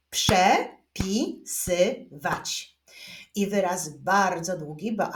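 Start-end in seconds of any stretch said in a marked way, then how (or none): 0.1-2.8 very slow, four separate syllables; long word split with pauses. Shown as a demonstration. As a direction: clap it out beat by beat. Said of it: "Gotowi do wyklaskiwania?".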